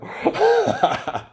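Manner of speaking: high laugh. Real, not acted. Laughing at Dom's answer.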